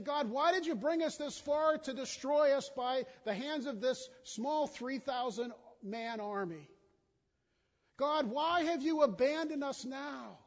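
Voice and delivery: high, loud anguished plea; pleading cry. Retold or imitated. Joshua blaming God, loud anguished plea. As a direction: cry it out like an accusation. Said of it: "He's saying".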